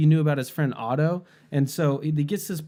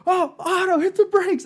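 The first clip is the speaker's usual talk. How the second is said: sudden high-pitched alarmed shout, louder; panicked yell; 4 dB louder than usual. Retold or imitated. Grandfather warning Otto as a plane attacks, high panicked shout. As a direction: scream the warning at the driver. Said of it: "He's like".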